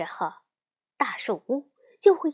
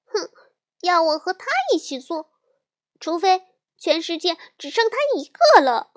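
high-pitched, indignant little-sister voice; huffy refusal; 7 semitones higher, 5 dB louder than usual. A narrator's reading. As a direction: refuse in a huff, raised and pitched up. Sulking sister refuses to sit with brother. (小妹妹尖着嗓子赌气拒绝)

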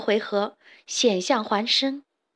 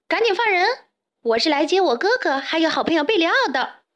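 high-pitched, earnest demand; serious; 6 semitones higher, faster than usual. A narrator's reading. Younger sibling demands captives' release, serious. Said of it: "严肃地说".

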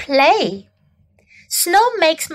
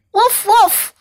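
higher-pitched imitated woofs; dog bark; 7 semitones higher than usual. A narrator's reading. Sam the puppy answers the kids, imitated barking. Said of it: "山姆汪汪地叫着".

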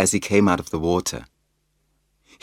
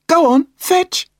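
much higher, louder urging call; 8 dB louder than usual. A narrator's reading. Mum urges Floppy into the lake, loud high call. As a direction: urge the dog on from a distance. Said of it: "she called".

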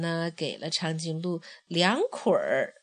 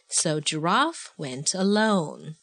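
ordinary delivery

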